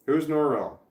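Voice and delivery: ordinary delivery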